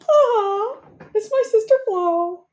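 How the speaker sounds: high, loud fake sob; mock crying. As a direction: whimper it like a pretend cry.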